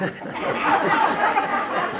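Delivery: breathy burst of laughter; real laugh. Real, not acted. Laughing at her own joke, a real breathy laugh. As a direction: break up laughing. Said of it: "what if I said".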